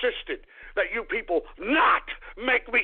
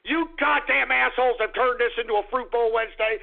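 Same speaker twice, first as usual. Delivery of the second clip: ordinary talk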